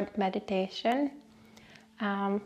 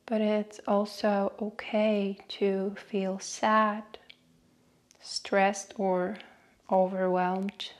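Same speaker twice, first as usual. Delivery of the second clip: guided meditation. Acted. Host leading listeners through a short breathing meditation, guided meditation voice. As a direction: guide them unhurriedly, letting each phrase settle.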